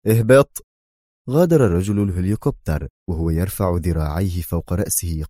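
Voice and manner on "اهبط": louder, stern one-word command; stern order. A narrator's reading. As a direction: bark it at the pilot. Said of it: "في صرامة".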